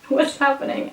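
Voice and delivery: ordinary talk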